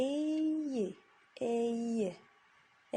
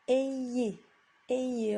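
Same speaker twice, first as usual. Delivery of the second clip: ayez with the I pressed a little, louder; stress on the I; 2 semitones higher, 5 dB louder than usual. Shown as a demonstration. Teacher leans on the I for pupils, slightly louder. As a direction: lean a bit on the I of ayez. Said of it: "On appuie un petit peu sur le I".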